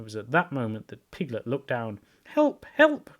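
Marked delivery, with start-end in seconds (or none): 2.2-3.1 high panicked squeals for help; terrified cry. A narrator's reading. Piglet fleeing the heffalump in a high panic. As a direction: shriek it out like a tiny frightened pig. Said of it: "cried Piglet".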